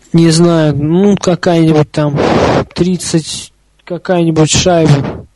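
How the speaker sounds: usual speaking voice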